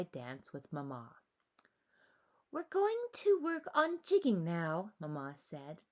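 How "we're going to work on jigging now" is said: higher, louder motherly voice; a bright mother starting the lesson; Mama's voice. A narrator's reading. Mama announcing the lesson to her daughter, high and lively.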